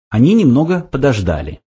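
plain reading voice

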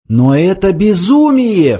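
high, drawn-out exclamation; mildly loud, slow outcry; crying out. A narrator's reading. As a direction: protest in alarm, pitch soaring. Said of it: "вскричала Триллиан".

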